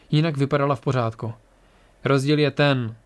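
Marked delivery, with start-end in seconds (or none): none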